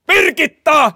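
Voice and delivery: loud high call across the office; loud calling shout. Retold or imitated. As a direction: yell her name to be heard.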